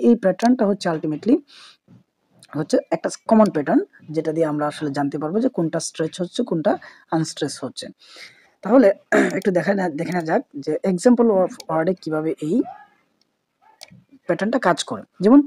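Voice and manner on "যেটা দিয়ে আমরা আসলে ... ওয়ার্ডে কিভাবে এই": normal talking voice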